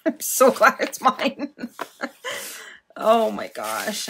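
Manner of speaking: laughing with delight; giggling. Real, not acted. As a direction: giggle it out with glee. Giggling over the gift.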